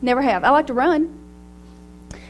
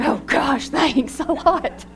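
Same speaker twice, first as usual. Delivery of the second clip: teary laugh, slow on "thanks a lot"; fake-scold him while laughing; laughing mock complaint; 2 semitones higher, much slower than usual. Husband's text made her cry.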